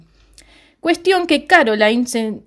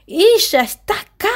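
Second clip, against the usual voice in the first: frightened warning; 8 semitones higher than usual. Retold or imitated. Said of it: "como diciendo".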